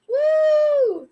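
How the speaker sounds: high, loud whoop of mock excitement; sugar rush whoop. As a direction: cheer like you're buzzing on sugar. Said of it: "Sugar rush".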